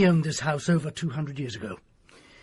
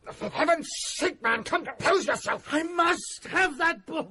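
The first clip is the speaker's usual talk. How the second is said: desperate plea; 14 semitones higher than usual. Acted. Doctor desperate for the book.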